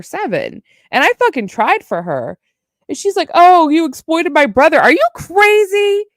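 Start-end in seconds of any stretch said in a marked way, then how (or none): 3.3-4.8 a mocking, louder imitation of an accusation; mock accusing Allie. Streamer mocking ex-friend's accusation, in an outraged mock voice. 4.8-6.0 outraged retort. Real, not acted. Outraged reply to accusation.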